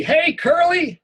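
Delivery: high, gravelly call across the schoolyard; Benny's raspy call. Acted. School bully calls to the boy across the yard, raspy. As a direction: rasp it out like a chain-smoking bully. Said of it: "all gravelly and raspy".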